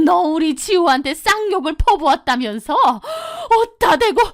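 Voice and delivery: jab a finger and rail at her; scolding mother-in-law. Acted. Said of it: "삿대질을". (삿대질하며 높이 호통치는 시어머니)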